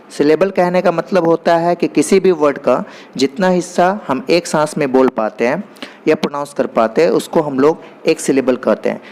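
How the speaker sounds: ordinary talk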